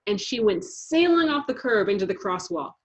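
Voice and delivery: ordinary delivery, nothing marked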